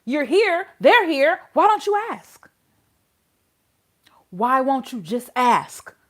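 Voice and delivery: heated, incredulous questioning; pissed off. Real, not acted. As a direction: press the judge in real frustration. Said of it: "that pissed me off so bad".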